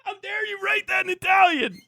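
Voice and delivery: high, loud mock fury; hammed-up outrage at a donor writing in Italian; mock outraged shout. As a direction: scream the scolding in fake indignation.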